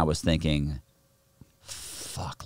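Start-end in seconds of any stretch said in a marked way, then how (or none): 1.6-2.4 whispered curse to oneself; dismayed whisper. Retold or imitated. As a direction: let it out under the breath, as a private thought. Retold dismayed thought to himself.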